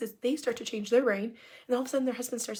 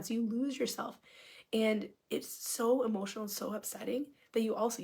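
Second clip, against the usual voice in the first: usual speaking voice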